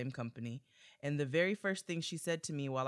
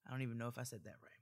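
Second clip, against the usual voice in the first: fast quiet aside; 5 semitones lower, 11 dB quieter, much faster than usual. Real, not acted. Quick aside after number.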